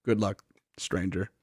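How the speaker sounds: low, hushed quote of a townsman; imitating a solemn send-off; quiet farewell. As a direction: say it softly, as if into a silent town. Townsman's farewell to departing hero.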